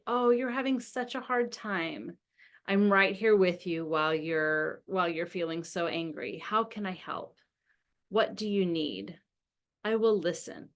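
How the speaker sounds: soft, low, calm reassurance; quietly supportive parent; peaceful. Acted. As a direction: soothe an upset child gently. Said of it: "me feeling kind of peaceful inside".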